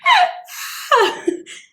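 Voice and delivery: high laughter. Real, not acted. Cracking up.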